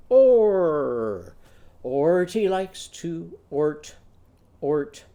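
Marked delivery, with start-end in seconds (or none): 0.1-1.3 a loud, firm or, like ordering; loud commanding or. Shown as a demonstration. Ordering shorts from the store, loud commanding or. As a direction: bark out or as if giving an order. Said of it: "show me ordering and go".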